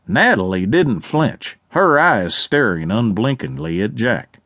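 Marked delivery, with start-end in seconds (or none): none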